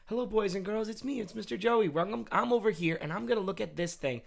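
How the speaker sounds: put-on high, sing-song kids' TV host; preschool teacher voice. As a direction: talk to little kids like a teacher. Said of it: "talking in my preschool teacher voice".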